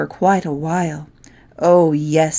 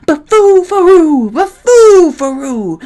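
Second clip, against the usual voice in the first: much higher, much louder bellowing roar, slowing on the second; roaring beast; 9 semitones higher, 8 dB louder than usual. Acted. Angry beast roaring its name after the poke in the ear. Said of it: "the beast who had begun to roar".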